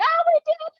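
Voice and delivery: very high, celebrating together; high-pitched mentor cheer. Retold or imitated. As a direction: squeal with joy at their win. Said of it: "And I'm like".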